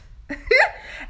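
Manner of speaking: high, loud real laugh; burst of laughter. Real, not acted. Laughing at her own retort.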